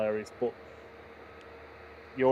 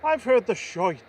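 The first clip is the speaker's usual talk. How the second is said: higher, mock Irish "shite" said with relish; Irish accent; 8 semitones higher than usual. Co-host jokes for the stream in a hammed-up Irish accent. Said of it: "saying that word in an Irish accent".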